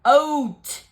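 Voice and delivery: loud one-word order; commanding. Acted. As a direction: bark it like a bear's command. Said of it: "commanded Bear".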